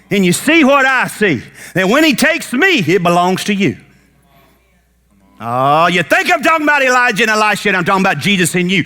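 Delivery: rising to a high, shouted climax with music under it; preaching at full force. Acted. Preacher shouting to the congregation at full force. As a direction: build the sermon till you're shouting over the organ.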